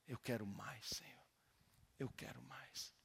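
a hushed plea to the Lord; whispered prayer. Shown as a demonstration. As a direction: breathe the prayer in a soft whisper. Voices a whispered prayer again.

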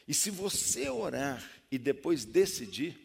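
loud, slow preaching build; forceful preaching. Real, not acted. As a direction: drive the point home.

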